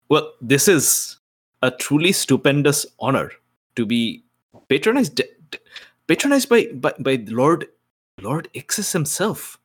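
strained, high, forced charm, stumbling; putting on the charm. Acted. Player forcing charm at the demon, high and stumbling. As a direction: gush with fake charm, stuttering. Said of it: "I'm putting on the charm".

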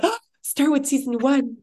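a touch of eager fan excitement; acted excitement. Acts out excited fan advice.

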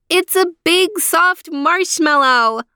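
put-on high, slow, delighted child's cry; excited little girl. A narrator's reading. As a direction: squeal it like an amazed kid. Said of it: "cried Leanie".

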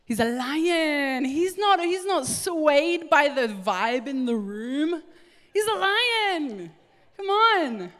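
high, loud, drawn-out preacher's cry; fervent preaching. Real, not acted. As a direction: build to a rousing pulpit shout. Preacher rises to fervent climax.

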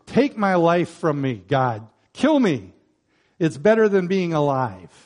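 loud, exasperated plea to God; angry. Acted. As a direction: act out an angry demand, hitting it hard. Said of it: "Now that's what you call angry".

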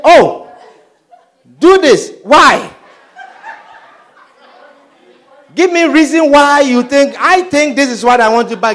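hammed-up demanding wife after the wedding; demanding spouse. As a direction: play the newly married partner who now demands. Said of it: "you say".

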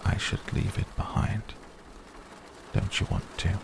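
soft, nearly whispered suggestion; hushed, with careful pauses; quiet. A narrator's reading. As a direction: urge gently under the breath. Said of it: "he said quietly".

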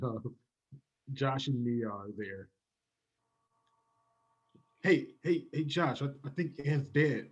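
ordinary delivery

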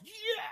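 short high-pitched mock bull scream. Hunter imitating a three point bull screaming. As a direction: squeal it like a young bull sounding off. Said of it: "He screams".

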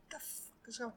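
a confused murmur under his breath; muttered to himself. Retold or imitated. In the bathroom, hearing his music blasting, a soft confused murmur.

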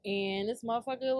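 ordinary talk

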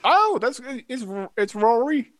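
high squeaky gnome voice; small high voice, a bit flustered. Acted. Gnome character voice.

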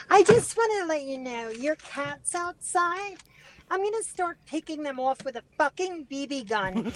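cat lady prank voice. Retold or imitated. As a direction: play the fake-sweet neighbour lady on a prank call. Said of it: "for you to do the cat lady".

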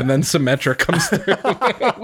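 ordinary delivery

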